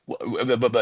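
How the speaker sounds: stuttered false starts; mock speechless stammer; flustered stammering. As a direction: sputter without finishing a word. Retold speechless reaction to the call.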